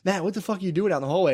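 high, fast scolding coach; imitated rugby coach. Coach finds player out in the hallway, quick high imitated scolding. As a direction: rattle it off as an exasperated coach. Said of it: "my rugby coach came out and was like".

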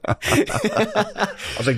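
ordinary talk, nothing marked

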